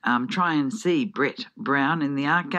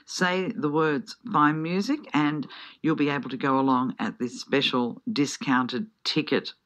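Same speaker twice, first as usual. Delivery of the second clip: ordinary talk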